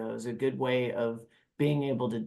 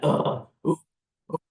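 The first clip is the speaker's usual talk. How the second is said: louder distorted ooh; false chord ooh demo; growled ooh demo; 3 semitones higher, 5 dB louder than usual. Shown as a demonstration. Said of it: "using different mouth shapes".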